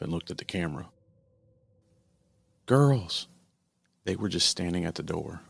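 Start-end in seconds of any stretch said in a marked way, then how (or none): 2.7-3.3 put-on loud, high call to the girls; excited shout. Mother spotting her missing daughters, loud excited call. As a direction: call out with sudden relief.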